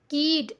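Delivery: कीड said with a long ee sound; wrong long vowel. Shown as a demonstration. Teacher showing pupils how not to read कीड. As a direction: stretch कीड out as the wrong reading. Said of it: "ऐसे नहीं पढ़ रहे हैं".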